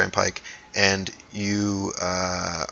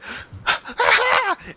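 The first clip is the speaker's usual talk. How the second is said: very high giddy halfling laugh; high-pitched acted cackle of joy; answering laugh; 4 dB louder than usual. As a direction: laugh back in a high squeal. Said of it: "Marvin's just like".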